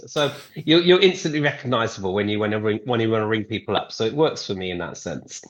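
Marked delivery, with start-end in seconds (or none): none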